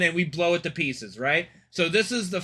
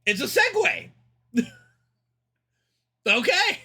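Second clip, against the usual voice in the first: high-pitched, incredulous reaction; taken aback; 11 semitones higher than usual. Real, not acted. Gamer reacting to an unexpected Segway reveal, high and incredulous. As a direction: squeak it out in disbelief.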